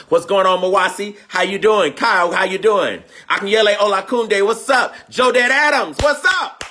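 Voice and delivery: loud, high greetings to friends; excited shout-outs. Real, not acted. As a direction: call each name out with joy.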